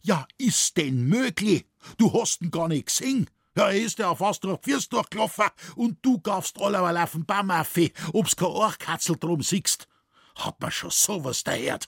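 high, agitated dialect tirade; exasperated father's rant, pitched high; scolding. A narrator's reading. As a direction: rail at your son in disbelief. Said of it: "im zankenden".

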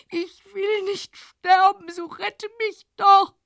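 loud pleading cry; desperate cry. Acted. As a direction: beg to be saved, crying out. Desperate plea.

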